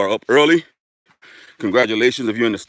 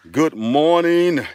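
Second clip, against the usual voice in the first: drawn-out, higher good-morning call; 6 semitones higher, 3 dB louder, much slower than usual. Real, not acted. Streamer greeting early viewers, long high call. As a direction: sing out a long, slow greeting.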